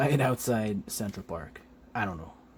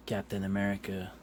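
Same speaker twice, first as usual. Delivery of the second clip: ordinary talk, nothing marked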